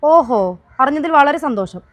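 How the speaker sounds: loud, high mock pleasure; sarcastic delight. Woman answering his offer to forgive her, sarcastic. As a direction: scoff with exaggerated gladness.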